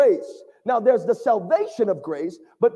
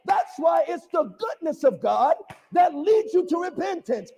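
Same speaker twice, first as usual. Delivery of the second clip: usual speaking voice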